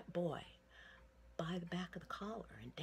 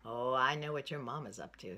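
put-on louder, knowing old shopkeeper; 3 semitones lower, 7 dB louder, faster than usual. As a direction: tease the boy kindly, out loud. Shopkeeper answers the boy.

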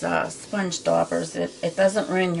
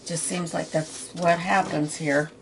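ordinary talk, nothing marked

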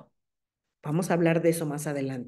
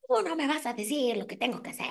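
high, fast mock outrage at being told to calm down; mock indignant retort; 8 semitones higher than usual. Mother told to calm down snaps back in mock indignation. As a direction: snap back like an offended mother, hammed-up. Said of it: "es así como".

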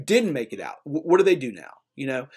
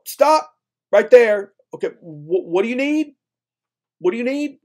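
hammed-up gatekeeper; 7 semitones higher, 5 dB louder than usual. Landowners calling out to a thirsty ship, mock stern. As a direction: play the wary landowner halting strangers. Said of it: "the people on the land are like".

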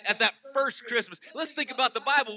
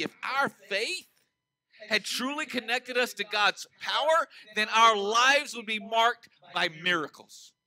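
slow emphatic preaching; slower than usual. Real, not acted.

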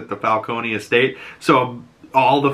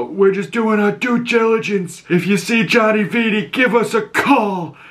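higher, strained chief voice; raspy chief voice; 10 semitones higher than usual. Retold or imitated. Police chief deflects Sophia, strained raspy voice. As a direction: croak it out defensively. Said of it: "you know".

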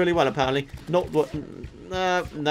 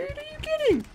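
pitched way up in disbelief; high incredulous squeak; 7 dB quieter than usual. Real, not acted. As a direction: squeak it out, can't believe it. Gamer exasperated at mob.

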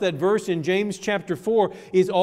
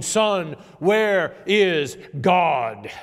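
stern, very drawn-out question; sterner; 4 dB louder, much slower than usual. Acted. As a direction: press each word slowly and firmly, like a clergyman confronting a child. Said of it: "in an even sterner tone".

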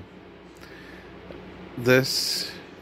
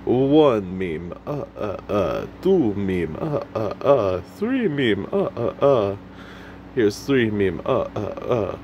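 hammed-up Muppet vampire counting, swooping pitch. Vlogger mimics his favorite Muppet counting memes, hammy vampire count. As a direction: count each one grandly, then a staccato villain chuckle. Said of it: "He's the count who counts".

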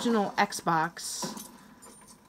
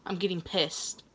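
much softer, lower, held-in anger; quiet seething; 5 semitones lower, 9 dB quieter than usual. Real, not acted. Gamer mutters low and soft about his growing anger. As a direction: mutter it low under your breath.

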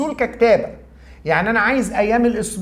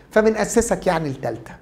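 ordinary talk

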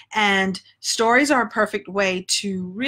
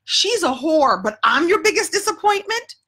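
stammered start, climbing high in outrage; indignant disbelief; 7 semitones higher than usual. Retold or imitated. Her twelve-year-old self reacting to the teacher, mock indignant. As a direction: protest the unfairness as a stung kid. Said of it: "And I remember thinking".

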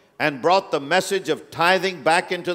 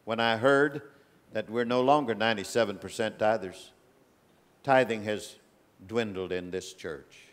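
much lower and softer, grieved; disappointed; 6 semitones lower, 7 dB quieter than usual. Real, not acted. Preacher telling his church of his disappointment, low and hushed. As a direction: let the letdown sink in quietly.

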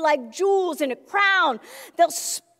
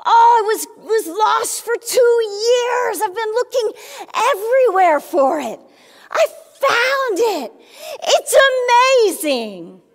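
higher, louder, breathless joy; overjoyed finder; 4 semitones higher, 5 dB louder than usual. Pretend. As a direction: burst out about the found ring. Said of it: "Be like".

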